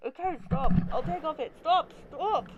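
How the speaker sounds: high, loud pleading; panicked protest. Acted. Tagger to arresting cops, agitated and pleading. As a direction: beg them to back off.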